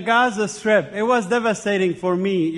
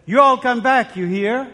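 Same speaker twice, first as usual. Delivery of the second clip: Southern farewell in a higher put-on voice; 5 semitones higher, 5 dB louder than usual. Guest imitating the recorded voice as he leaves. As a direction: sing out a Southern goodbye, higher at the start. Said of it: "her voice would say".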